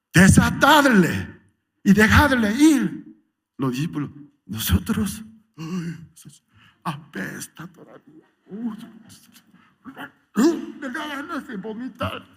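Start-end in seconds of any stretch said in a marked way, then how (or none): 0.1-3.2 high, slow, loud command; a grand divine order. Acted. Jesus commanding disciples. 4.5-12.3 disgusted. Acted. Disciples disgusted by stench.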